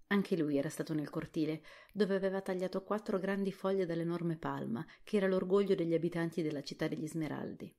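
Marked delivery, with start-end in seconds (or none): none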